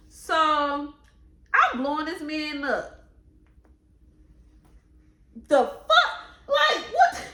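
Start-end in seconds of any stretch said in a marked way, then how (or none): 5.5-7.3 high, loud indignant shock; outraged disbelief. Real, not acted. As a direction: explode in real disbelief. Outraged at his lies.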